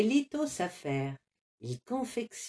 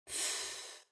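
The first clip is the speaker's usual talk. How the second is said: soft unvoiced puff of annoyance; annoyed huff; 17 dB quieter than usual. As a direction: blow out air, fed up. Carmelito soupire d'agacement. (Carmelito souffle, agacé)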